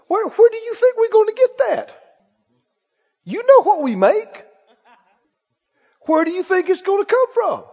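high, pressed complaint at the kitchen table; exasperated money worry. Retold or imitated. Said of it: "talk like this in their kitchen".

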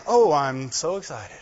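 low, unenthusiastic mock excitement; fake excitement. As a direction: claim excitement with none in the voice. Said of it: "Not the ones that say".

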